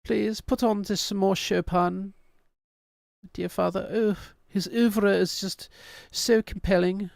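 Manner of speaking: put-on high, prim British child voice; a hammed-up British kid asking politely; posh British child. Kids begging father in mock British accent. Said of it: "And they're like".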